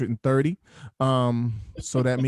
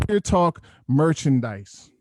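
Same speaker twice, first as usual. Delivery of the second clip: slow, raised-pitch ceremonial unveiling; grand announcement; 4 semitones higher, slower than usual. Pretend. Host unveils the show's merchandise, grand announcement. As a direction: draw it out like a big reveal.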